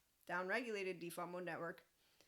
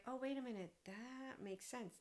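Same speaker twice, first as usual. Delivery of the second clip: higher voice, softening and slowing at the end; dawning realization; 5 semitones higher, 4 dB quieter than usual. Acted. Acting out a listener who suddenly gets it, dawning realization. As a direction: let the penny drop gradually.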